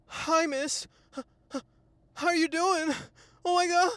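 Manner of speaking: high, loud, jittery nervous greeting; nervous shaky guy. Retold or imitated. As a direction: play a guy shaking with nerves in front of a woman. Nervous guy shaking approaching woman.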